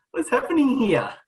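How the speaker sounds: high, startled "what's happening here"; shocked surprise. Retold or imitated. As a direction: react like you just got sprayed. Replays shocked quote, high.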